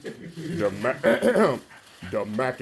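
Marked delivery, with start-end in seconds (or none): none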